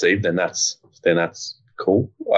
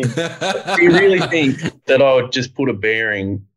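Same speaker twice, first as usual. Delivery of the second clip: slow, higher, through laughter; incredulous question while still laughing; laughing disbelief; 8 semitones higher, slower than usual. Real, not acted. Guest answers people claiming no clutch, laughing in disbelief.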